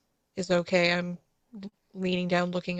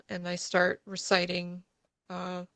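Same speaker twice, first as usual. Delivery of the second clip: normal talking voice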